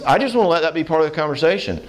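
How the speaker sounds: ordinary talk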